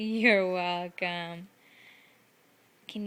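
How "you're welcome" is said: slow sweet reply. Real, not acted. Answering toddler's thank you.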